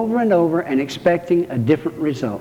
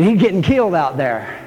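ordinary talk, nothing marked

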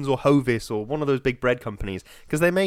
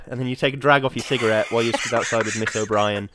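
usual speaking voice

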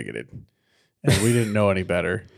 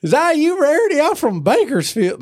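hillbilly window washer; 7 dB louder than usual. Acted. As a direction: ham up an excited country bumpkin. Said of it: "the hillbilly window washer".

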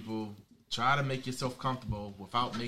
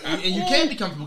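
laughing; 8 semitones higher, 12 dB louder than usual. Real, not acted. Laughing while making his point.

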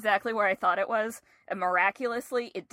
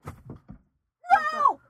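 high, sudden cry of alarm; panicked shriek; 6 dB louder than usual. Real, not acted. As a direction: yelp in real panic. Spider escapes, real panic.

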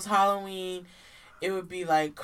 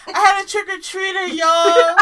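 high, loud excited telling; 11 semitones higher, 13 dB louder than usual. Real, not acted. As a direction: burst out with the news. Excited about trick-or-treater.

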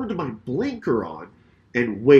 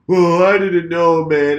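drawn-out, loud, higher-pitched excuse; mocked other driver; 4 semitones higher, 8 dB louder, slower than usual. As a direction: imitate him lamely protesting, stretching the words. Other driver's excuse, mockingly acted.

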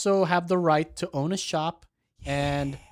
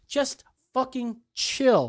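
slow, high, drawn-out words; exasperated plea; 5 semitones higher, much slower than usual. Real, not acted. Co-host telling the anti-mask guy off, exasperated. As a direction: stretch each word out in exasperation.

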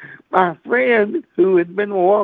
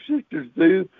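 ordinary delivery

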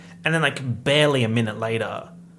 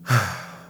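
heavy sigh; distressed sigh; 4 semitones lower than usual. Real, not acted. Vlogger in distress over the film, heavy sigh. Declares distress, then sighs.

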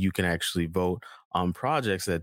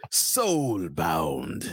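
deep announcer voice; 4 semitones lower than usual. Retold or imitated. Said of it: "like a voice".

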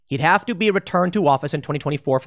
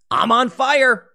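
higher, louder cry of a cornered bull; mock desperate bull; 7 semitones higher, 4 dB louder, slower than usual. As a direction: voice the burning bull's panic. Bull speaking in metaphor.

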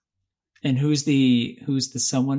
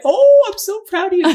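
excited praise; 11 dB louder than usual. Real, not acted. Teasing praise after a right guess.

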